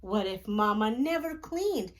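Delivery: plain reading voice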